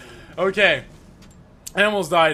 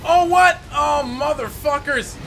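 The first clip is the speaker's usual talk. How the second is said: shocked outburst; 6 semitones higher, 4 dB louder, slower than usual. Real, not acted. Second enemy appears.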